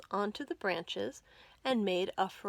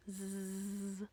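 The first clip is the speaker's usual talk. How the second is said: quiet drawn-out snore; soft snore; 2 semitones lower, 11 dB quieter than usual. A narrator's reading. Storyteller snoring softly as Uncle Willy sleeps.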